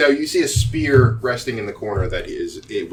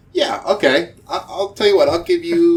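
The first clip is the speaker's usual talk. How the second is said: higher, louder character voice; rattled merchant; 8 semitones higher, 6 dB louder than usual. Acted. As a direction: give in, pitched up and loud. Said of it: "he's like".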